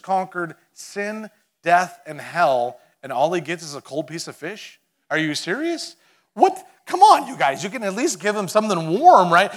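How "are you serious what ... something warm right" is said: much higher and louder, comic outrage; exaggerated protest for laughs; mock disbelief. Mock outrage at cold fish.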